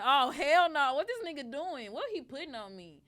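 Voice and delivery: loud, high outraged yell; outraged shout. Retold or imitated. Finding her cut-out face, outraged loud yell. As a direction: blow up at the trash can find. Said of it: "I said".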